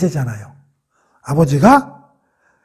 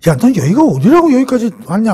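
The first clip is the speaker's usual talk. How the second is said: acted scolding, pitched higher; 4 semitones higher, 4 dB louder than usual. Older brother scolding young David at the battlefront, scolding. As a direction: voice an older brother telling someone off. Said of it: "형이 막 야단을 쳐".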